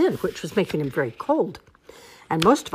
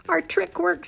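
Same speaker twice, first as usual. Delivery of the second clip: high, excited little voice; gleeful badger voice; 14 semitones higher than usual. A narrator's reading. Badger to the animals after the trick, high glee. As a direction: gloat in a high, small voice.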